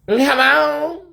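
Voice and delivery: very high rising hello. Retold or imitated. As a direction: answer the door in your own high voice. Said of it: "That's what I said".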